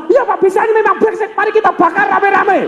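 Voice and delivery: fan the customer's anger; mock teller stoking the fury; egging him on. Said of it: "Kemudian Bapak Ibu langsung".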